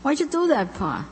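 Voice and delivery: high, startled question to her father; a daughter's startled question to her old father; surprised. Acted. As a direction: react with sudden disbelief.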